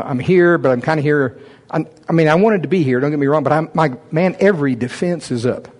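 ordinary talk

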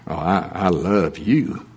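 tender, drawn out. Shown as a demonstration. A preacher showing how inflection changes I love you, slow and doting. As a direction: sigh it out like a lovestruck sweetheart.

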